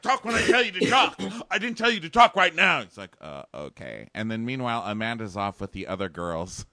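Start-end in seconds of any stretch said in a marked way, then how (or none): 0.0-2.8 high, loud boyfriend impression; imitated exasperated bellow; mock furious yell. Voicing Josh shouting orders at Nick. 3.2-4.1 low, soft giving-in; small, deflated impression; mock meek reply. Voicing Nick meekly giving in.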